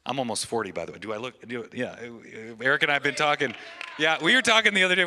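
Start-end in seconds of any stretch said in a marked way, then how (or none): none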